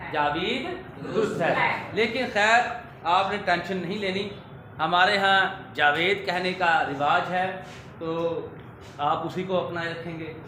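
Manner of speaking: usual speaking voice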